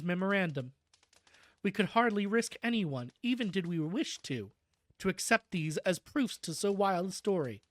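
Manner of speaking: steady storybook reading voice; story narration. A narrator's reading. Streamer reading the book's ending aloud, storybook narration. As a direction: read the closing pages to the chat. Reads the novel's closing narration.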